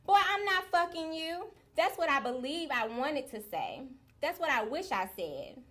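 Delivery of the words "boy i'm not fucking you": high, sassy imagined comeback; defiant refusal. Acted. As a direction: refuse outright, pitched well up.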